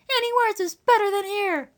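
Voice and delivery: high, wildly exclaiming voice; wild country woman. A narrator's reading. Eliza wanting Lily out of the house, wild high voice. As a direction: insist wildly in a high rustic voice. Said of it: "said Eliza wildly".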